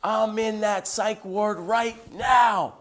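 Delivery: high, drawn-out retold shout; imitated screaming. Stranger cries out on hearing of psychiatric ward, screaming. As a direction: imitate someone crying out at full tilt, slowly. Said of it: "screaming at the top of his lungs".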